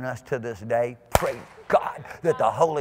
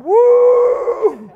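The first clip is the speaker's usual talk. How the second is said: very high, loud whoop of joy; Abraham's whoop; 14 dB louder than usual. Retold or imitated. Abraham cheering, empowered by God, ecstatic. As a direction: let out a wild cheer. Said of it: "He was going".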